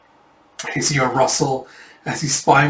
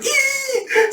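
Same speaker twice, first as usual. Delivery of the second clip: very high, thin squeal; sudden high-pitched mock squeal; imitated squeal. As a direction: squeal "eee" far above your voice. Imitated reaction in retold scene.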